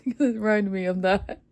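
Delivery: usual speaking voice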